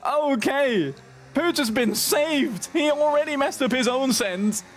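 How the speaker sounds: high, fast-building race call; excited commentary. Real, not acted. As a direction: shout the crash call in disbelief. Frantic call of crash.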